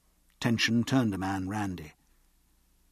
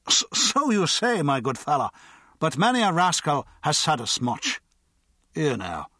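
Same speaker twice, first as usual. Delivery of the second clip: much higher, stammering tipsy start; slurring, hiccup into the words; hiccuping drunk; 6 semitones higher, 4 dB louder than usual. A narrator's reading. Hiccuping drunk lectures the guard, stammering and pompous. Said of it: "The drunk hiccuped".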